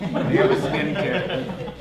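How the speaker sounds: slow, laughing through the words. Real, not acted. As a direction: chuckle while saying it. Joking about a minor scrape.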